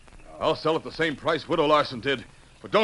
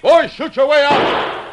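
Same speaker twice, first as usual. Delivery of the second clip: slow, high, loud shouted command; shouted order; 14 semitones higher, 8 dB louder, slower than usual. Acted. Munson ordering his men to shoot out, shouting slowly. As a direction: bellow the order to fight. Cornered boss orders gunfight.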